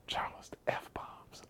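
mimicked whispered scolding; slow, whispered mock admonishment; hushed reproach. Someone scolding Charles for cursing at kids camp, whispered.

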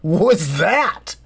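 slow, high, incredulous outburst; a monk losing his patience; snapping at him. Retold or imitated. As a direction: drag out the shock as you snap. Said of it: "and he snaps".

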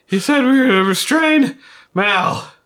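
high, loud urgent report; nervous character. Acted. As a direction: rush the news out.

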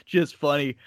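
ordinary talk, nothing marked

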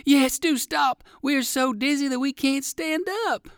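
high-pitched dizzy fox voice; crying out. A narrator's reading. Other dizzy fox begs the dog to stop, crying out. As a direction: plead high, starting with a loud cry. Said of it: "cried the other fox".

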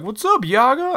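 high, chirpy mock greeting; stranger's greeting. Imitating people who recognize him, high and friendly. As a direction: toss it off like an eager acquaintance. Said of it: "they're like".

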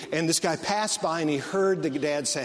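normal talking voice

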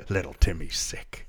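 hushed, slow fake parent whisper. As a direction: whisper it like a sneaky phone call. Fake sick call to school.

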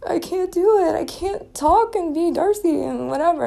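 high, tearful retelling of a breakdown; retold crying. Vented to J B, high and tearful. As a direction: pitch jumps way up, pleading and near tears. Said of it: "I cried to J B".

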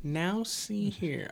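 put-on old movie gentleman. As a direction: play a pompous movie Englishman. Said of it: "that was an English thing".

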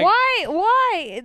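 loud, high-pitched exasperation; exasperated demand. Real, not acted. Woman demanding an explanation of the teasing, loudly exasperated. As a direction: cry it out in protest.

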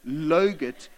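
slow "logurt", L replacing the Y; child's mispronunciation. Retold or imitated. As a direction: draw out "logurt" with an initial L. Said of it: "she'd say logurt instead of yogurt".

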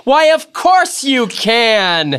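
high, slow, drawn-out disbelief; incredulous cry. A narrator's reading. Gatsby answering Nick's warning about the past, incredulous. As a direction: stretch it out, astonished anyone would doubt it. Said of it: "he cried incredulously".

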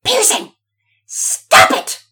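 high, loud scream at a brother; exploding in anger. A narrator's reading. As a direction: erupt into an angry shout. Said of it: "she exploded in anger".

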